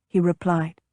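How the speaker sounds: plain reading voice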